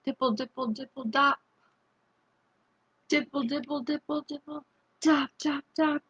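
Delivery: quiet pitter-patter of raindrops; hushed raindrop sounds; soft rain patter. Retold or imitated. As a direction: tap it out softly like rain on a roof. Imitating raindrops for children.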